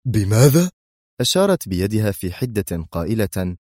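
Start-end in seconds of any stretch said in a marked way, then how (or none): 0.1-0.7 startled one-word question; taken-aback cry, modestly raised; shocked exclamation. A narrator's reading. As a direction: burst out in disbelief. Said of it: "وهو يتراجع بحركة حادة هاتفا".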